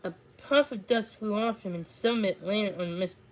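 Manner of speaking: ordinary delivery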